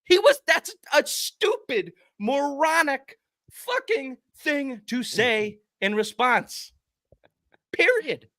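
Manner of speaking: furious high-pitched tirade; furious outburst. Real, not acted. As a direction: let it rip at full fury. Peak of the rant.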